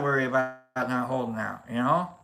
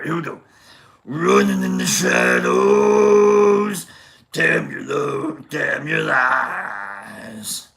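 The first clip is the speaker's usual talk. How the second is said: slow, loud, gravelly monster singing; growl-sing it like Cookie Monster; 2 semitones lower, 4 dB louder, slower than usual. Pretend. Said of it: "Cookie Monster?".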